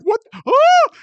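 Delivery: shrieking "What? Ah!" high and loud; a sudden terrified yelp; panicked scream. Retold or imitated. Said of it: "everyone's like".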